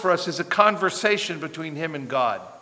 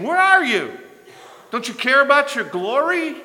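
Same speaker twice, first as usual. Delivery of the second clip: much higher, louder plea; anguished cry; 9 semitones higher, 6 dB louder than usual. Acted. Prophet demanding that God answer, much higher and louder. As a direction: cry it up to heaven.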